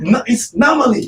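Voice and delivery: high correcting voice; imitating her correction. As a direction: mimic her setting him straight. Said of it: "she was correcting me".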